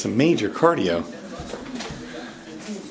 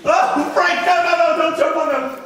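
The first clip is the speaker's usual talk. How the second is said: urgent high scolding; 14 semitones higher than usual. Real, not acted. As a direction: stop the cat mid-jump. Stopping the cat from jumping.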